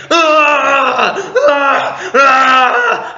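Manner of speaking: high, loud mock tantrum screams. Fan imitating Kylo Ren's lightsaber tantrum, screaming. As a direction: imitate repeated screams of childish rage. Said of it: "biggest temper tantrum I've ever seen".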